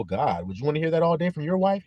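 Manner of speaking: ordinary talk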